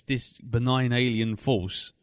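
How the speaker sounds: ordinary talk, nothing marked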